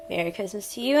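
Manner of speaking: plain reading voice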